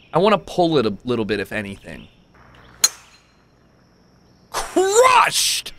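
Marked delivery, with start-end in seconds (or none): 4.5-5.7 excited shout. Real, not acted. Celebrating drive.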